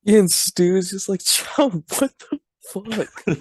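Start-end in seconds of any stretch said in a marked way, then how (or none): 1.2-3.4 high, slow, stretched-out disbelief; mock appalled. Stu watching Chow eat dog food, mock appalled. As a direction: drag it out in high-pitched dismay. Acting out Stu's appalled reaction.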